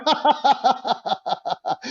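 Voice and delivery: high, rapid cackling laugh. Real, not acted. Panellist reacts to the other man's outburst, laughing. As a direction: laugh out in a long run.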